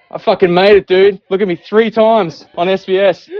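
loud, high triumphant boast; gloating triumph. Acted. Gloating shout-out to old counselor.

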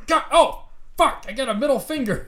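shocked yell. Acted. Cohost discovering finger grew back, shouted.

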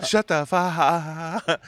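"fuck" said as an open, drawn-out "fah"; mocking drawn-out vowel. Shown as a demonstration. Bar-goer mocks how a guy says Antifa, stretching the vowel. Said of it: "why do you gotta say it like that?".

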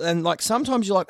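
ordinary delivery

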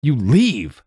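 high-pitched defiant shout. A narrator's reading. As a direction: order them out at the top of your voice. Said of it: "she shouts, in a voice bigger than her lungs".

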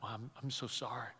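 very soft, hushed condolence; gentle, quiet sorrow for a broken man; soft sympathy. Retold or imitated. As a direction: barely breathe the apology. Pastor consoling a betrayed man.

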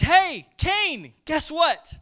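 a bright divine hail to get Cain's attention; God calling Cain. Retold or imitated. God intercepting angry Cain, calling out high and loud. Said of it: "And God speaks to him".

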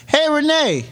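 teasing hello to a coworker; mock greeting. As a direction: call her name out cheerily. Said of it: "this whole rant was about us saying".

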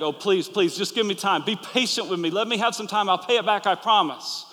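retold servant begging on his knees. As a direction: plead for time like a desperate debtor. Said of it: "he began to beg".

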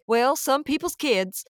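slow, high, hammed-up Southern drawl for a friend; exaggerated Southern accent. Quoting her friend Brenna from down south, exaggerated Southern accent. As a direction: lay the Southern accent on thick and slow, teasing. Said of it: "I always exaggerate it".

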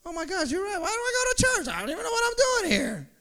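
gullible believer. Acted. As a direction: rattle it off like you've been fooled. Deceived believer agrees, shaken.

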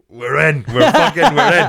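high, loud burst of triumph; mock elated shout. Gus reacting to everyone laughing, excited shout. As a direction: crow it like a gag just landed.

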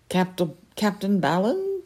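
high, imperious lady's voice; haughty falcon lady. Acted. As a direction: speak down like a proud noblewoman. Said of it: "said the Peregrine".

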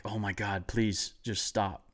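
soft, slow exasperated plea; muted pleading. Retold or imitated. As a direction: beg under your breath, dragging it out. Begging yelling grandpa to stop.

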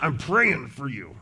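a flat, dutiful "I'm praying for you"; grudging. Shown as a demonstration. Pastor mocking a half-hearted promise to pray, grudging. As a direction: say it like a chore you're stuck with. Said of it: "But it's not".

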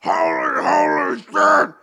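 shouting furious Hulk, voice cracking at the top; Hulk rant. Acted. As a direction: build from a gruff growl to a roaring Hulk meltdown. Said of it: "I'm gonna do it as the Hulk".

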